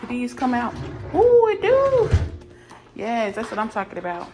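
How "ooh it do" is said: delighted surprise. Real, not acted. Delighted discovery of drawers.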